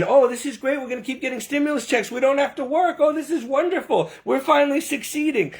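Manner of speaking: mock gleeful voice. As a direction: parody naive people cheering handouts. Mocking complacent citizens.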